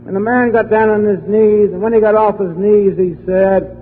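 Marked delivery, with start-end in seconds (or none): none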